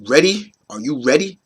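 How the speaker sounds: ordinary delivery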